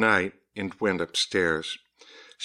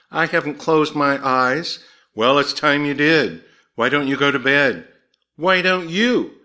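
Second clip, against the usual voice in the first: mildly testy, raised bickering; louder and higher, trading quick objections; 6 semitones higher, 6 dB louder than usual. A narrator's reading. Wife and husband squabble over bedtime, mildly testy.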